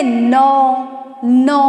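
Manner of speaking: नौ stretched slowly with an open au; नौ said slowly, the au vowel held; drawn-out au. Shown as a demonstration. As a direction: hold the au in नौ long so it rings from the throat. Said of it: "औ की ध्वनि निकल रही है ना".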